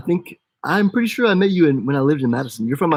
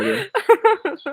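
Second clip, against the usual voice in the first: laughing. Acted. Joking she is her child.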